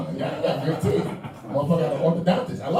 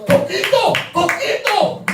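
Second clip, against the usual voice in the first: high, loud call of Coquito; excited shout; 14 semitones higher, 5 dB louder, slower than usual. Real, not acted. Comic hyping Coquito to the New York crowd, shouting. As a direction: cheer the drink's name. Hyping Puerto Rican drink.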